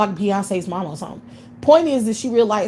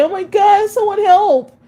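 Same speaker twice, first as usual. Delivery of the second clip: shrill, much higher and louder mock panic; mock panicked cry for help; 12 semitones higher, 6 dB louder than usual. Mocking a man who clutches the kids in a crisis, hammed-up panic. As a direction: ham up a helpless man's panic. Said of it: "And clutch the kids and say".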